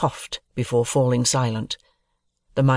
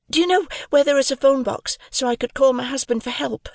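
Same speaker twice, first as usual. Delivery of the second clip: put-on high, timid young woman; sweet, shy plea for help; 10 semitones higher than usual.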